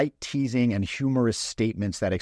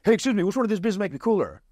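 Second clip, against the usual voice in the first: much higher and fast, words tumbling out; overeager rush; 10 semitones higher, 3 dB louder, faster than usual. Retold or imitated. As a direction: pop out of nowhere and blurt the whole line. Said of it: "He just pops out of nowhere".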